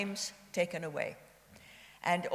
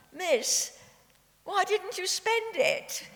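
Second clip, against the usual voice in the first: higher, louder, a lightly voiced curious schoolboy; little boy asking; 12 semitones higher, 5 dB louder, slower than usual. Schoolboy asking a survivor, in a lightly voiced child's tone. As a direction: ask it like a puzzled little boy, half laughing. Little boy's question retold.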